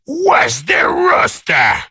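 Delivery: slow, high bellowed demand; demanding shout. Acted. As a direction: call for the rooster like an order. Colonel demanding the rooster.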